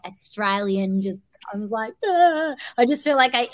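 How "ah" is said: short mock scream, high and loud. Actor showing how accent slips when screaming, mock scream. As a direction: let out a pretend cry, pitched way up. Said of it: "or really scream".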